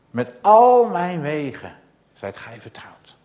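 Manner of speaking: loud, slowing sermon climax; preaching at full force; fervent preaching. Acted. Preaching climax, psalm quoted.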